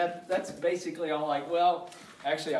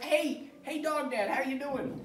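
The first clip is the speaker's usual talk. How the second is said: high-pitched talking dog voice; little Scout voice; 7 semitones higher than usual. Acted. Dog greets his owner, in a high little Scout voice. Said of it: "he has his own little Scout voice".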